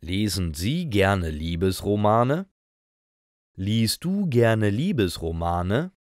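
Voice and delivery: usual speaking voice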